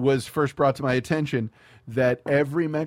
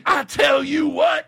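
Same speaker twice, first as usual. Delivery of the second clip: shouted; 13 semitones higher, 6 dB louder, slower than usual. Real, not acted. Shouted exclamation.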